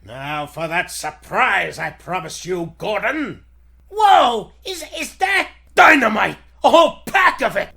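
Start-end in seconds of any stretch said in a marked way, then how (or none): 3.9-7.7 high, loud alarmed cry; panicked discovery. Acted. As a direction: yelp in fright at the dynamite. Captives see the dynamite.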